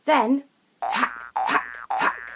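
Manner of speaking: low hacking cat cough sounds. A narrator's reading. Cat coughing up a hairball, hacking.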